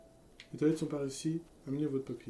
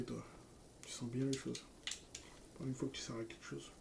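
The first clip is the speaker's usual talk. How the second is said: usual speaking voice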